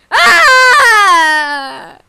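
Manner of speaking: long, high, loud cry; tantrum scream. Pretend. Screaming before onlookers, long high tantrum cry. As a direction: let out a drawn-out "Ah" scream.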